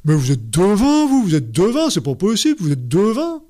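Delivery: mock astonishment, voice pushed up and out; amazed patient. (patient stupéfait, imité avec étonnement)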